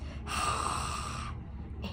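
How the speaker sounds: long soft hiss; owl hiss. A narrator's reading.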